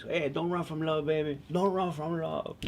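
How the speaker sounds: a conga player hollering after her, lightly voiced; calling out across the park. Conga player calling after a running woman, mock holler. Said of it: "He's yelling out".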